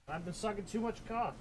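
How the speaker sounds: hushed, raspy joke voice; raspy whisper. Pretend. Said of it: "talking too much".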